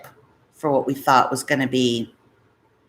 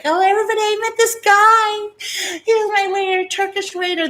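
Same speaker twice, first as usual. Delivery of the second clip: giddy gushing; 3 dB louder than usual. Retold or imitated. Telling coworkers at the bank about her new guy, giddy gushing. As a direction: burst in to tell the office everything.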